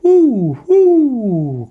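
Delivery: loud, high whooping cheer; joyful whoops. Acted. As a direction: whoop for joy while flying. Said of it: "cried little Pip-Pip".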